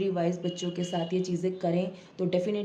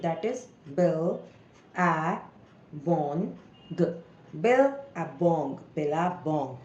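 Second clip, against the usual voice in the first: slow, separated syllables of "बिल, a, Bong"; syllable breaking; 2 semitones lower, much slower than usual. Shown as a demonstration. Teacher guiding a child through a long word, slow syllables. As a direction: chop "बिल a Bong" into clear slow pieces. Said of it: "तो आप उसको break कराइए".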